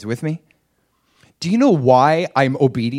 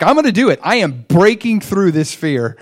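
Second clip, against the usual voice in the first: much higher, louder resolve; determined vow; 5 semitones higher, 7 dB louder than usual. Retold or imitated. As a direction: psych yourself up to act. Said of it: "So I'm like".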